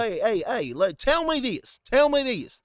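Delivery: high, loud Randy Moss country drawl; mock thick country accent; country accent imitation. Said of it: "Randy Moss got the most country accent".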